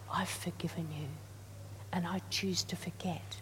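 soft, hushed voice of God forgiving; gently reassure, nearly a whisper; tender divine voice. Retold or imitated.

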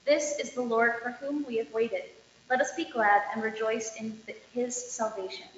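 ordinary talk, nothing marked

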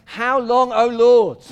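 high, loud cry of lament; a pleading lament shouted to heaven; anguished cry. Acted. Pastor cries out the psalm.

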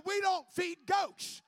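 shouted repeat. Acted. Preacher repeating his point to the church, shouting slowly. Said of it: "I said".

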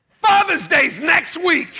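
high, slow, loud announcement; indignant shout. Acted. Jealous dad reminding the congregation, indignant shout. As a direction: holler it as a pointed reminder. Mock protest over kids favouring mom.

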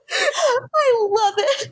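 high, drawn-out, laughing gush; laughing delight. Real, not acted. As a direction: say it slowly through real laughter. Delighted laughter at wild party.

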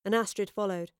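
usual speaking voice

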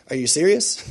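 ordinary talk

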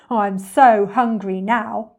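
loud, slow greedy grumble; ravenous crocodile complaining to himself; hungry crocodile. Acted. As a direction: drag out the hunger loudly.

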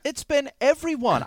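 high, whiny exasperation; exasperated complaint. Acted. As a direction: whine it, fed up. Fed up with interruptions.

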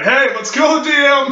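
loud laughing call, pitched high. Pretend. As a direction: shout it so he hears from afar. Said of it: "I guess he's too far away".

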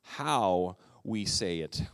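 each word of how we say it drawn out slowly; preacher stressing how we say it; slow emphasis. Real, not acted. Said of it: "it's important".

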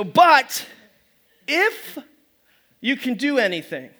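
"But" punched loud, then a long pause before "if"; "But" and "if" each hit high and alone; slow stress on but if. Shown as a demonstration. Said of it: "Notice these next two words".

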